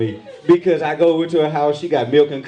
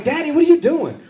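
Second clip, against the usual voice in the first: put-on whiny teenage daughter; 10 semitones higher than usual. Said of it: "She was like".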